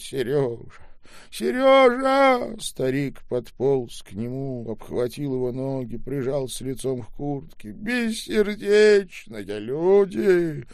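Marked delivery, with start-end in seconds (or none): 0.0-2.6 much higher, louder slow calls; pleading old man. A narrator's reading. 7.7-10.7 much higher, louder, slow; wailing old man. A narrator's reading.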